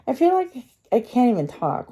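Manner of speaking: words thickened by a retainer in the mouth; talking with a retainer. Real, not acted. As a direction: push the words past a retainer. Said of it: "I have my retainer in my mouth".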